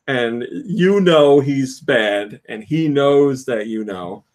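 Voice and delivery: ordinary delivery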